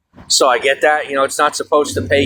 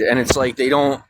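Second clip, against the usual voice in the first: ordinary delivery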